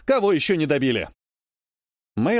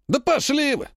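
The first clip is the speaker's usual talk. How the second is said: much higher, indignant outburst; mock angry retort; 9 semitones higher than usual. Teased soldier snaps at his mocking comrades, higher. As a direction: throw it back at the teasing comrades.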